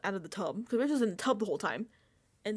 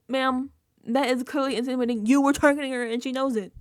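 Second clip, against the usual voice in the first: much louder exasperated ranting; loud exasperation; 3 semitones higher, 10 dB louder than usual. Real, not acted. Scolding Mickey through the screen, loud exasperation. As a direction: lay it out, fed up.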